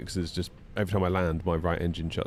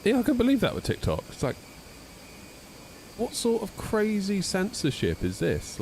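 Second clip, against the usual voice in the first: indignant; 12 semitones higher than usual. Real, not acted. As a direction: rant at the censorship. Ranting about TikTok.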